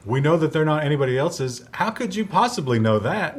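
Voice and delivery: loud, high-pitched disbelief; incredulous. Retold or imitated. Disputing an interviewee's UFO claim, incredulous. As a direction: build from pointed quote to exasperated question.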